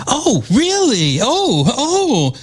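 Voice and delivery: high, drawn-out swooping mock outrage; mocking arguing woman. Host mimics congresswoman's clown show, mocking high drawn-out squeal. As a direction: sing out a sarcastic, high-pitched squawk of one-upmanship. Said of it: "She sounds like, um, that she's arguing".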